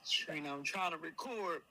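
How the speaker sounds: low, soft irritated reply; hushed reply. Retold or imitated. Imitating Bryson shushing Trina while recording. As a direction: drop low and hush it. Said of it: "he said".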